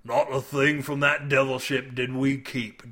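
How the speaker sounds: understated hiss in character. A narrator's reading. Drinkwater on the devil ship, hissing. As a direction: hiss it as a grim confession. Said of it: "Drinkwater hissed".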